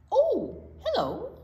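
high, bright hello to a new friend; cheery greeting. Acted. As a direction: greet them with sudden delight. Octopus greets imagined friend.

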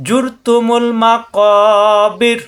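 জুর said with a thick, heavy r, slowly chanted; heavy ra in Quran recitation. Shown as a demonstration. Quran teacher shows students the heavy ra, slow chanted recitation. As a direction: stretch out জুর with a full, heavy r. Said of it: "জুর মোটা".

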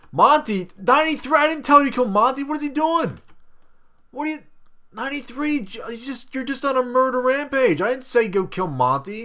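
high-pitched panicked yelling at his pet; frantic high yelling. Real, not acted. Scolding his pet ninety-three for killing Monty, in a frantic high-pitched voice. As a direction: shriek at the pet to stop.

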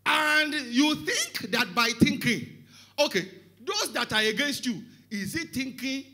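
loud, high sermon challenge; full-force preaching. Real, not acted. Preacher on those that are against you, full-force preaching.

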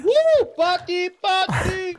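party cheer. Real, not acted. Celebrating the reunion.

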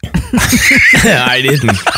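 laughing through the words. Real, not acted. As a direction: deny it while laughing. Laughing off a joke.

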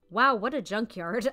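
ordinary delivery

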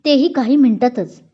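ordinary delivery, nothing marked

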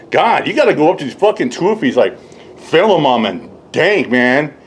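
ordinary delivery

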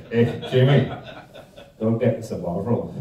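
loud, bantering Scottish football fan; hammed-up Scottish accent, half laughing. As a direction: bark it like a big Glasgow Rangers lad. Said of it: "excuse me trying the accent".

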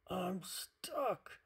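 high, strained-out cry for help. A narrator's reading. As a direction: cry out as if wedged tight. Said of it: "cried Hippo".

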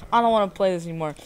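ordinary delivery

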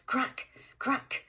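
high sharp crack crack as gunfire; rifle crack. Acted. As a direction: snap it out like two shots. Rifle shots.

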